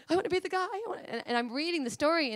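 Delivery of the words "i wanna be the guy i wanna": high, breathless eagerness; eager pleading. Retold or imitated. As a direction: beg to be chosen. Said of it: "draw straws kind of thing".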